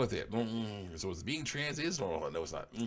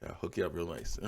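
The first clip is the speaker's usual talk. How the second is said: normal talking voice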